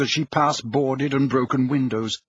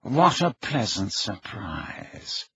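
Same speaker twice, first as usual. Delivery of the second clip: slow, low, softly ironic; slow Snape voice; 5 semitones lower, 5 dB quieter, much slower than usual. Acted. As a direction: drawl the greeting, mock polite. The man greets her.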